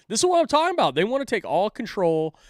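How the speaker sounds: high and loud, fired up; excited. Real, not acted. Host introducing the clip Jordan sent, fired up. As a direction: burst out with it, pointing at proof.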